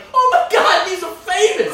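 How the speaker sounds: very high, loud hammed-up gushing; mock amazed. Comic mocking visitors to the hot dog stand, high gushing excitement. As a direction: squeal it like a starstruck visitor. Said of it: "been like".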